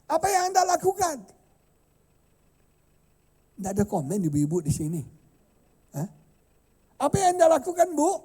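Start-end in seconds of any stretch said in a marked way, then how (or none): 0.1-1.2 repeats the question loudly, pushing for an answer; loud demand. Real, not acted. Preacher to silent mothers, loud insistent question. 7.0-8.1 louder still, daring the mothers to answer; loud challenge. Real, not acted. Preacher to mothers in the pews, loud challenge.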